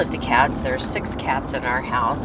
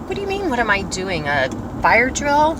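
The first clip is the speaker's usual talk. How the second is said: sarcastic retort; 8 semitones higher, 4 dB louder than usual. Retold or imitated. Her exasperated retort, retold.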